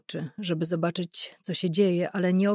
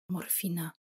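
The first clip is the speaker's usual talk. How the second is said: a faint breath of a word, much softer; whispered; 10 dB quieter than usual. Acted. Injured Irena begs the interrogator for morphine, whispered. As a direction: barely voice the word, drained. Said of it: "wyszeptała".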